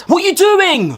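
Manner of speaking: high shout at the TV; yelling at the ref. Acted. Fan to the referee on TV, high shout. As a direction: yell at the referee in disbelief. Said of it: "Not you, the ref".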